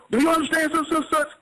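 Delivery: a court clerk's question, pitched high; mimicking the official asking; mock court official.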